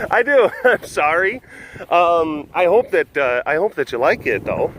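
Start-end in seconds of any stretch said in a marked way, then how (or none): 0.1-1.4 giggling, higher and louder; sheepish laughing sorry; laughing apology. Real, not acted. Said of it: "I apologize for that".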